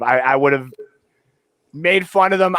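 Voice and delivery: ordinary delivery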